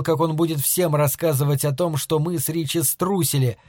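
ordinary delivery, nothing marked

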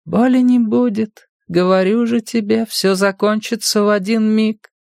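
high, distant sing-song voice; strange radio voice. A narrator's reading. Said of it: "короткие всплески голосов звучали странно".

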